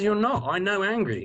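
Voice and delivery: a lightly high mock psychiatrist voice; imitating the psychiatrist. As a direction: psychiatrist overruling the patient, mildly high mock voice. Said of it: "And he goes".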